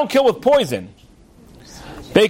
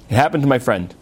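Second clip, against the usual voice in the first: dry echo; 8 semitones lower, faster than usual. Shown as a demonstration.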